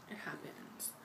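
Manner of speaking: breathy whisper, barely voiced; whispered aside. Real, not acted. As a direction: drop to a resigned whisper. Bitter aside after complaint.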